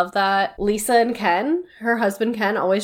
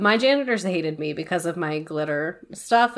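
usual speaking voice

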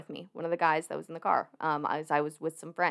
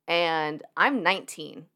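usual speaking voice

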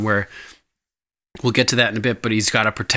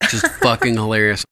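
ordinary talk, nothing marked

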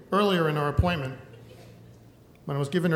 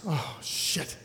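dropped to a dismayed near-whisper, much softer; quiet dismay; 8 dB quieter than usual. Retold or imitated. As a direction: let it slip out under your breath, crushed. Seeing bald spot in mirror.

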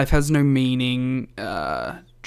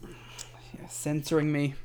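whispering; 10 dB quieter, slower than usual. Real, not acted. Mock complaint whispered.

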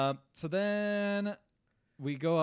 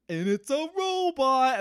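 higher, slow, drawn-out reveal; grand reveal; 8 semitones higher, 5 dB louder, much slower than usual. Retold or imitated. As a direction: stretch it out like a big surprise. Big reveal of the robot.

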